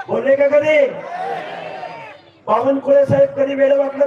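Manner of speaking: fiery rally-stage shout. Real, not acted. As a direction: hammer each question at the crowd. Rally crowd, rhetorical questions.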